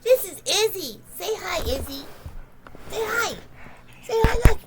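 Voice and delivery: high, loud sing-song pet voice. Real, not acted. Baby talk to the dog.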